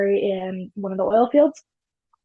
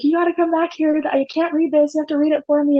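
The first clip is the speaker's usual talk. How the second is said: higher-pitched urgent appeal, lightly acted; urgent retold plea; 6 semitones higher than usual. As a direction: re-enact pleading with a friend in a raised pitch.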